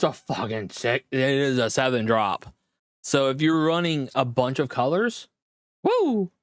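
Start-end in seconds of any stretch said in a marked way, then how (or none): 5.8-6.3 excited woo. Real, not acted. Excited about a strong card.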